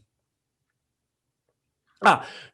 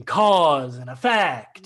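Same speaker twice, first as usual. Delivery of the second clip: slow sneer; 6 dB louder, much slower than usual. Acted. Sneering at her show.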